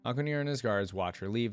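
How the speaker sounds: ordinary delivery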